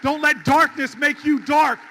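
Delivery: fiery campaign close; full rally preaching. Acted. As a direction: rouse the crowd, hammer each line. Rallying crowd finale.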